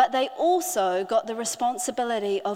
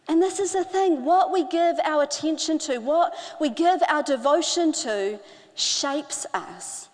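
normal talking voice

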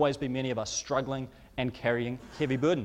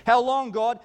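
higher, louder desperate plea; anguished cry; 7 semitones higher, 6 dB louder than usual. Retold or imitated. David crying out to God in despair, loud and high. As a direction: cry it up to heaven.

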